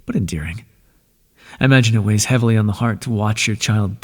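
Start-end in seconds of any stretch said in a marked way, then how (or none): 0.0-0.6 a tender whispered aside; fond whisper. Acted. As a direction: whisper it with affection. Fond aside about Icarus.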